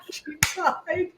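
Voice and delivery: real laughter through the words; laughing. Real, not acted. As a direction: laugh it out, tickled by the story. Laughs at the punchline.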